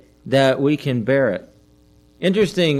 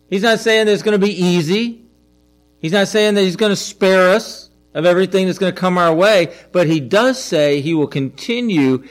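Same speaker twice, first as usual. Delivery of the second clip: rising, louder sermon climb; preaching build; 5 semitones higher, 4 dB louder than usual. Acted. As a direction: drive the point home to the congregation.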